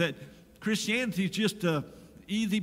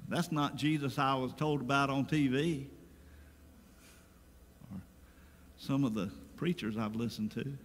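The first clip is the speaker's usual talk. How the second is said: ordinary talk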